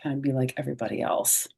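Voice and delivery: usual speaking voice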